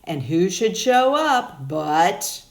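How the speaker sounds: ordinary delivery, nothing marked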